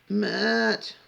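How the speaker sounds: high, loud mock call-out; hammed-up high-pitched calling after someone; pathetic stage voice. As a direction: imitate her pleading shout, drawn out. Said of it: "fucking pathetic stage voice".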